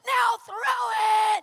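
high, loud rallying order; shouted command. Retold or imitated. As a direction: command the whole room at full voice. Woman commanding crowd, retold.

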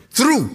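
through lifted high and held; stress on through. Shown as a demonstration. Preacher to congregation, through punched high. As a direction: hit the word through hard. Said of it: "that word is again".

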